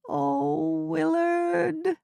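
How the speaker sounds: high, drawn-out moan of despair. Acted. Wife moaning over her jailed husband, high and despairing. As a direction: moan his name helplessly. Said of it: "she moaned".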